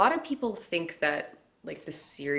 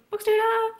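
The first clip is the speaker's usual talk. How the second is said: excited shout; 6 dB louder than usual. Real, not acted. Cheery sign-off shout.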